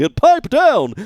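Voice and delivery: high, loud tough-guy bark; thug snapping. Pretend. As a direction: snap at him to shut up.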